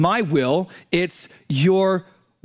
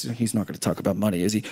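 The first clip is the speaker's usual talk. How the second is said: muttered congregant aside; 11 semitones lower, 6 dB quieter, faster than usual. Acted. Voices a wary congregant's aside.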